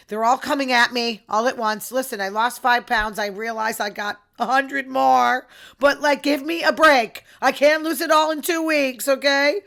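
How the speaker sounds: loud, rising-pitched plea for a break; exasperated protest. Real, not acted. Woman to her diet-pushing sisters, loud exasperated protest. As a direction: push back loudly, fed up.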